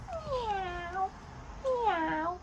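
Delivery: high, soft, stretched-out meow; cat meow. Shown as a demonstration. Asking a child to say meow, soft high cat call. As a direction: mew softly and high like a little cat. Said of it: "A cat says meow".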